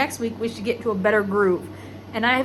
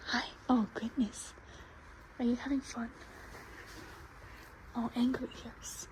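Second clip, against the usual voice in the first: soft cooing; 14 dB quieter than usual. Real, not acted. Cooing softly to a horse.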